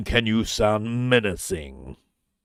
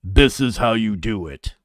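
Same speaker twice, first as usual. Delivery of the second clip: lower, louder commanding read; deep narrator voice; 5 semitones lower, 5 dB louder than usual. Shown as a demonstration. Streamer showing the proper deep trailer delivery. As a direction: announce it with weight. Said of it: "and you sound like this".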